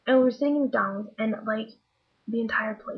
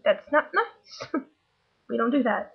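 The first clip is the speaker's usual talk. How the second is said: mock scolding; 3 semitones higher, slower than usual. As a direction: scold gently while stifling a laugh. Parent scolds child, laughing.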